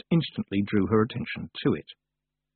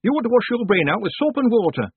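high, loud village woman's rebuke; scolding; 13 semitones higher, 6 dB louder than usual. A narrator's reading. Woman prodding the joking man in the chest, sharply scolding. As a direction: tell him off at a high pitch.

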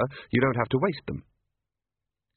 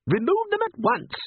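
very high imperious order; commanding mother. A narrator's reading. Mother orders Igor, high and imperious. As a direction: command the servant like a grand lady. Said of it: "Mother commanded".

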